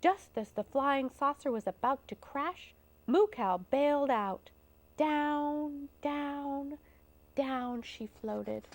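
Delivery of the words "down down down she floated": soft, spaced words fading away; hushed, unhurried narration; gentle floating descent. A narrator's reading. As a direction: let each "down" drift softer.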